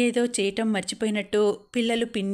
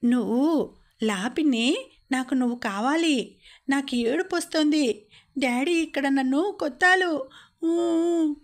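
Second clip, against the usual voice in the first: a small child's mock sobbing plea, rising higher; crying child; 5 semitones higher than usual. A narrator's reading. Anu begs her aunt on the phone, mock crying child. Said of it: "అంది అనుజ్ఞ ఏడుస్తూ".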